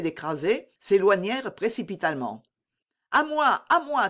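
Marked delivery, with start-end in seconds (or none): 3.1-4.1 high-pitched urgent call for help; crying for help. A narrator's reading. D'Artagnan feigning trouble on his bolting horse, crying out for help. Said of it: "criait D'Artagnan".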